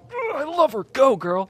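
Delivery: high, excited cheering; gushing fan cheer. Retold or imitated. Fan rooting for Chimera's story, high gushing cheer.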